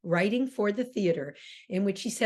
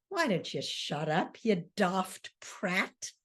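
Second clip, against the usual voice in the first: contemptuous put-down; 2 semitones lower, 5 dB quieter, slower than usual. Retold or imitated. Son insults his father.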